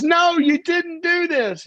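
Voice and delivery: high, loud, drawn-out cry of protest; slow, high-pitched "no" of dismay; mock group outcry. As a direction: wail it out in disbelief as the group. Group protesting in retold story.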